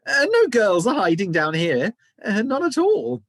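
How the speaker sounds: high, loud flustered denial; boy lies to the giant, squeaking; nervous squeaky lie. A narrator's reading. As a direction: squeak it out to cover up.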